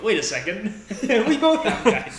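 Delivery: mock sudden realization, cracking up; laughing retelling. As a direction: crack up while quoting the group. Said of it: "we were like".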